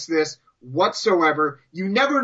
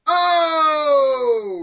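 high mock-outraged howl; 8 semitones higher, 4 dB louder than usual. Real, not acted. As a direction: howl it like a burn landed. Reacting loudly to friend's jokes.

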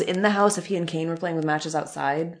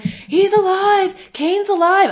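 high, slow melodramatic wail; Paul Bearer impression; 13 semitones higher, 4 dB louder, slower than usual. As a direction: stretch out the shock reveal in a high voice. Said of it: "Paul Bearer's like".

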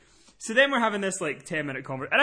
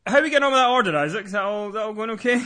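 raised, over-hearty opening; abrupt subject change; 5 semitones higher, 5 dB louder, faster than usual. Retold or imitated. Caller steering back to his order, loud and abrupt. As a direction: burst in loudly to dodge the topic.

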